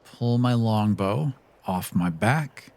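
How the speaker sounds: normal talking voice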